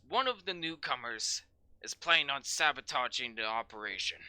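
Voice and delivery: gruff sergeant building to a loud rant; angry sergeant. Acted. Sergeant berating the newcomers, building to a shout. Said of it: "Sighs and says".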